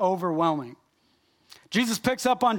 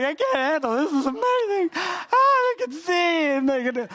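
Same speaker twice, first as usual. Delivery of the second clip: overwhelmed excitement; 10 semitones higher, 4 dB louder than usual. Retold or imitated. Preacher reliving watching the videos, high overwhelmed gushing. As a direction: pitch jumps way up, voice cracking with awe. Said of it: "and I'm like".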